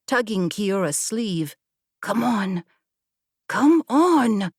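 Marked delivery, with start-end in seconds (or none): 2.0-4.5 frantic urging. A narrator's reading. Himari frantically begging Kiora to leave before they are seen, panicked. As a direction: pull her away in rising panic.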